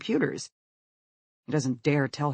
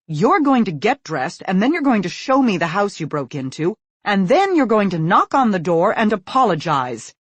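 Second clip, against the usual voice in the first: loud, firm commands; stern orders; 5 semitones higher, 9 dB louder than usual. A narrator's reading. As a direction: lay down the law. Mother laying down law.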